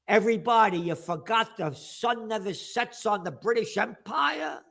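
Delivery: ordinary delivery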